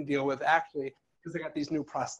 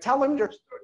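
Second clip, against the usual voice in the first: pushy parent; 8 semitones higher, 4 dB louder than usual. Retold or imitated. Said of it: "don't march your kids over".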